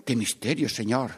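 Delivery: ordinary talk, nothing marked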